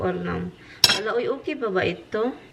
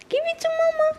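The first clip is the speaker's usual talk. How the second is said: much higher sing-song coaxing. Real, not acted. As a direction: coo to the dog to hand it over. Coaxing dog to give toy.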